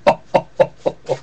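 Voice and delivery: airy little jolly chuckle; jolly laugh. Acted. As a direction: laugh like the belly shaking. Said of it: "shook when he laughed like a bowl".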